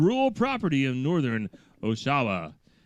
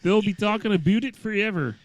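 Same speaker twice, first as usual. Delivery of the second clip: put-on light Canadian accent, a bit higher; 8 semitones higher, 4 dB louder than usual. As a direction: quote the officer in a Canadian accent. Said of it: "my Canadian voice".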